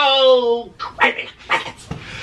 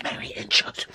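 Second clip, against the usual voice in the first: whispered; 9 dB quieter, faster than usual. Real, not acted. Exclamation trailing to whisper.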